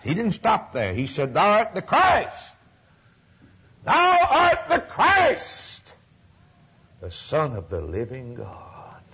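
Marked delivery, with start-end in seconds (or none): 1.3-2.5 ringing confession. Acted. Preacher quoting Peter's confession. 3.9-5.8 high, slow, loud proclamation of Thou art the Christ; soaring cry. Acted. Preacher crying out Peter's confession to the congregation, soaring. 7.0-9.0 whispered, awed, softer; hushed whisper. Acted. Preacher hushing Peter's words to the church, whispered.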